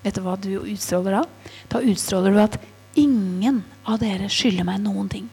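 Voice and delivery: ordinary talk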